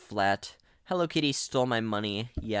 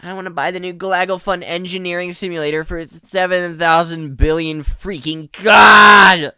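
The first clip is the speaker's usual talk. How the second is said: mock rant; 5 semitones higher, 14 dB louder than usual. Mocking players who pity the ghast, building to a yell. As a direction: ham up a whiny fan until it explodes.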